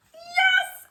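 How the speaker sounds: very high, loud cheer. Acted. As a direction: whoop it with delight. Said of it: "Andres cheered".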